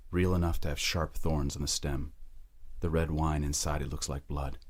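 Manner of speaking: normal talking voice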